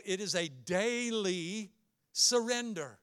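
DAILY stretched out slow and emphatic; stress on DAILY. Shown as a demonstration. Preacher correcting the one-time-decision idea, heavy stress on DAILY. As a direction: draw out the word "daily", giving it full weight. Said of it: "put the emphasis on the word daily".

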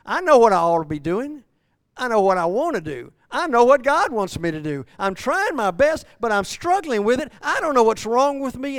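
loud, high, straining confession; anguished Paul. Acted. Preacher voicing Paul's struggle, loud and anguished. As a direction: cry out Paul's frustration. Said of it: "Paul is saying".

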